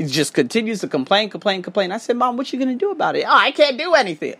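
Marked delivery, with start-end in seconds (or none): 2.2-3.2 fast questioning. Retold or imitated. Quoting himself to his mom. 3.3-4.3 whiny mom. Retold or imitated. Voicing complaining mom.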